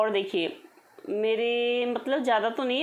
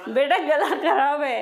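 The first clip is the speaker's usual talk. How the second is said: slow, laughing reply to son; mother laughing, teasing son about his throat; 4 semitones higher, 5 dB louder, slower than usual. Real, not acted. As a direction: tease him while laughing.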